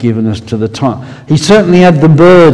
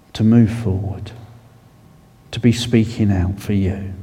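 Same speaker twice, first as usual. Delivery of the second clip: prayer; 2 semitones lower, 4 dB quieter than usual. Acted. Preacher praying for persecuted Christians, hushed prayer. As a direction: pray aloud for the persecuted, hushed.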